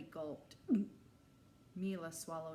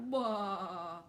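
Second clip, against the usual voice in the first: louder, playful Bah; mock monster roar; 7 dB louder than usual. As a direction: boo like a joking monster. Boy mock-roars joke.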